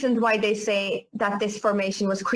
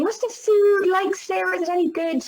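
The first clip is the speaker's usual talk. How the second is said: higher-pitched mock questioning; imitating curious customers; 7 semitones higher than usual. Customers asking Sarah about food in Ireland, imitated in a high voice. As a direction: lift the voice to mimic a much-repeated question. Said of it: "We get this all the time".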